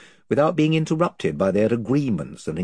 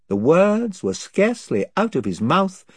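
ordinary delivery

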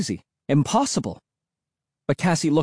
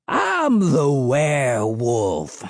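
crazed hermit; much slower than usual. A narrator's reading. Mad hermit chasing kids.

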